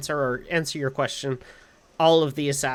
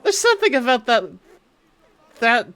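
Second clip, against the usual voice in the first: fast, high, through laughter; laughing; 10 semitones higher, 8 dB louder, faster than usual. Real, not acted. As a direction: still giggling while talking. Laughing at a silly game hint.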